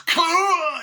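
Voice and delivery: very high, loud, drawn-out cry; hammed-up shriek; mock yell. Doll hitting a man, high mock shriek.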